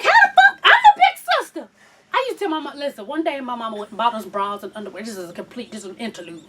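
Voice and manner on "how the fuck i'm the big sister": high, loud indignant cry; loud mock-jealous squawk; jealous outrage. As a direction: protest it like the cheated big sister. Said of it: "I'm like".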